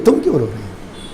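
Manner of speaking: a hushed, gentle question to someone weeping; soft tender repeat. Acted. Husband asks crying wife why. (بہت دھیمی اور نرم آواز میں دوبارہ پوچھنا)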